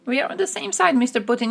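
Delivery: high mock-fawning voice. Israel flattering Putin, high mock-fawning voice. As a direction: simper up to Putin. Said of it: "it's almost like that".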